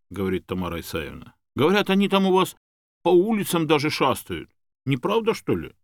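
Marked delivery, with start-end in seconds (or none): 1.6-5.7 high, loud questioning; naive gossiping woman. A narrator's reading. Woman asking about crocodiles in the streets, naively worried. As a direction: pass on a rumour in a raised voice. Tamara passes on wild rumours.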